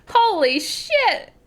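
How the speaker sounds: high, slow holy shit; retelling the moment of spotting a celebrity; awestruck exclaim. Retold or imitated. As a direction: draw it out in disbelief. Just passed Zach Braff.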